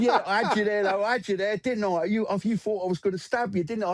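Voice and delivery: gloating gangster. Retold or imitated. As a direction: rub it in, lightly voiced gangster. Gangster gloating after the prank.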